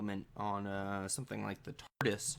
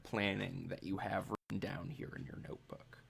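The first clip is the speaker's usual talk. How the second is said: whisper; 4 semitones lower, 6 dB quieter than usual. Real, not acted. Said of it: "GM voice drops unvoiced".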